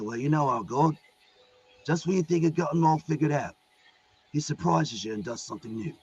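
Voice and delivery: lightly voiced British action hero; a hint of British tough-guy swagger; Jason Statham. Gabriel answers Michael about God, light British action-hero voice. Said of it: "so those are the voices".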